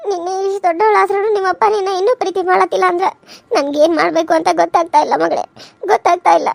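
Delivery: grieving mother. Acted. Mother soothing her crying daughter, low and sorrowful. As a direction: console your daughter through your own guilt.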